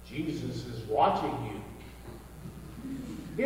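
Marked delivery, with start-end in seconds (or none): 0.1-1.6 a hint of a low, eerie voice from the dark; lightly spooky, lower and softer; eerie disembodied voice. Parrot hidden in the dark, lightly eerie low voice. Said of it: "a strange disembodied voice echoed from the dark".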